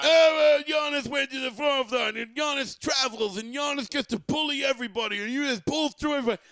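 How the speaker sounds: whiny Celtics fan. Retold or imitated. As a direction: mimic a fan crying about calls, high and shouty. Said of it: "all they did was bitch about the officials".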